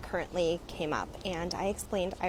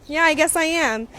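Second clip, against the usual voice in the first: high, loud imitation of her date; loud mocking concession; mock admission; 9 semitones higher, 7 dB louder than usual. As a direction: imitate him owning up, pitched up.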